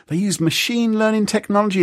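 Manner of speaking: usual speaking voice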